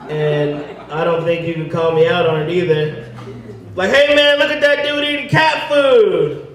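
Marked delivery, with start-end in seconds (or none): 3.9-6.5 passerby mocking him, high and jeering; mocking heckler. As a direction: jeer at the cat-food eater. Heckler voiced.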